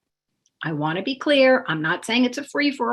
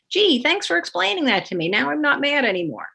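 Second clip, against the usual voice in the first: high, mock-chirpy child voice; sarcastic grateful child; 7 semitones higher than usual. Child mockingly thanking parent, high chirpy sarcastic voice. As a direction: play a child suddenly and falsely cheerful. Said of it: "the child says".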